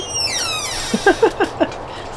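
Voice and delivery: very high giggle; squeaky laugh. Real, not acted. Laughing after greeting bee.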